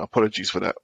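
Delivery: usual speaking voice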